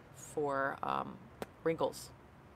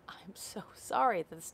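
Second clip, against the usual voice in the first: whispered sympathy. Real, not acted. Sympathy for Leslie's skin.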